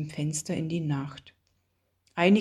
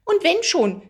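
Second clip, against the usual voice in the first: high, loud defensive retort; indignant cry; 12 semitones higher, 7 dB louder than usual. A narrator's reading. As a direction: throw it back sharply, pitch leaping up. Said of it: "rief Alice ungehalten". (ungehalten gerufen)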